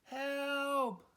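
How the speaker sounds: high cry for help; balloon crying help with no one answering; cried. Acted. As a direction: call out into the empty sea. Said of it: "cried Sorry".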